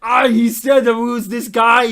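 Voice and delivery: very loud hammed-up muscleman voice; bellowing action star. Translated exchange between action stars, comic bellow. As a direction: bellow it like a movie tough guy.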